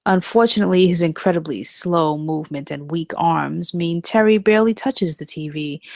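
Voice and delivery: usual speaking voice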